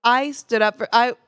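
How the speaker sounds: ordinary talk, nothing marked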